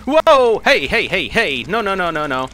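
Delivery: loud, high whoa easing into repeated protests; real gamer panic, settling down; startled yell. Real, not acted. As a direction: yelp in alarm, then fend it off. Startled by danger in the game.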